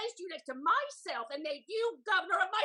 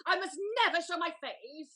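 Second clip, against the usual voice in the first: normal talking voice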